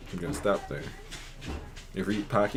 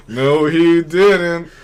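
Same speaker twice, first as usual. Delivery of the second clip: slow, loud "oh no he didn't"; loud, slow mock outrage at the teasing; mock scandalised; 4 semitones higher, 9 dB louder, slower than usual. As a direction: drag it out like trouble is brewing.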